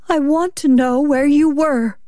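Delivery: high, pressing demand; insistent wife. Acted. Wife questioning her husband, insistent and high.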